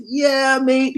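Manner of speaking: high, excited British mate imitation; high-pitched British-accented cheer. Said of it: "He's like, in his accent".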